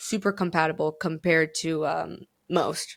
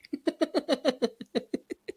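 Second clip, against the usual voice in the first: high laugh, trailing on; 6 semitones higher than usual. Real, not acted. Joking about men on a podcast, high laughter. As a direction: break up at your own joke. Laughs at own crude joke.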